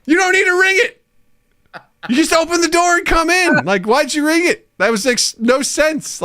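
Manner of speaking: high-pitched retold rant; hammed-up parental exasperation, pitch way up; aggressive reaction. Dad scolding daughter for needless ringing, exasperated. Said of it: "I have an aggressive reaction to that".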